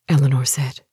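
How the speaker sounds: plain reading voice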